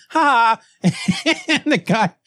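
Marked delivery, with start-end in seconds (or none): 0.1-0.9 high, spoken "ha ha"; mock laugh. Gambler admitting she has no money, mock laugh. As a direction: fake a cheeky laugh. Acting gambler's cheeky admission.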